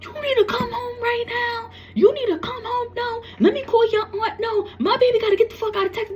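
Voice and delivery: put-on a mother begging her child to come home right now; panicked mother. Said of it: "I called my mother".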